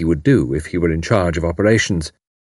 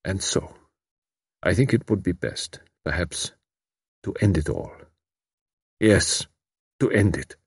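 much softer, hushed despair; quiet resigned despair; quietly; 6 dB quieter than usual. A narrator's reading. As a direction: let the words sink to almost nothing. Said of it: "He added quietly".

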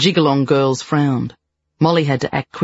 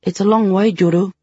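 nervous; 5 semitones higher than usual. Acted. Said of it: "Daisy sounded nervous".

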